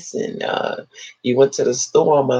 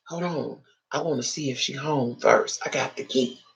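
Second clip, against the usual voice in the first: much softer, hushed; mock whisper; 4 semitones lower, 9 dB quieter than usual. Intruder whispering to his friend, hushed. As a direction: hush it like a clumsy burglar. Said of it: "he can't whisper for nothing in the world".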